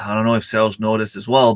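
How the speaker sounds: ordinary talk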